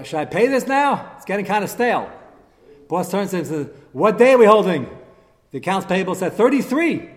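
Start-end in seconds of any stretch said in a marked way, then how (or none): 0.0-2.1 raised voice, higher and louder; retold yell across office. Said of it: "yelled out to the boss". 3.9-4.9 loud, higher retold shout; boss calling back. Boss calls back across office. 6.3-7.1 high, loud retold call; yelled answer. Employee yells answer to boss.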